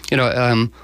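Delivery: ordinary delivery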